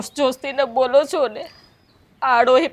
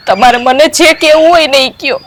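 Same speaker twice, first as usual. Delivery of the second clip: loud, offended shouting; angry shout; 9 dB louder than usual. Acted. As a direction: defend her father loudly. Angry quarrel reply.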